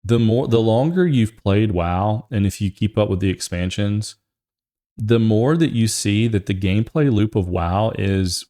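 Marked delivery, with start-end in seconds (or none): none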